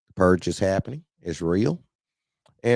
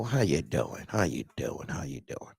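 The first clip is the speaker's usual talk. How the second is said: each take lower and softer, the last one whispered; greeting fading to a whisper; fading repeat; 8 semitones lower, 8 dB quieter than usual. Real, not acted. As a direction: let it trail off to a whisper.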